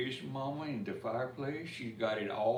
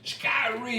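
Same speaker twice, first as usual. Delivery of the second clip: high yelp of surprise; sudden high cry of shock; startled exclaim; 12 semitones higher, 7 dB louder than usual. Acted. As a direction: yelp it out as if just burned. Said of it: "he exclaim".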